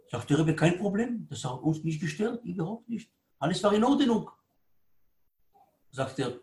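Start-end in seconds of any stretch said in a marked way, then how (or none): 0.5-4.3 quiet, forgiving rabbi; acted calm, hushed reassurance; soft reassurance. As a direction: wave the apology away softly.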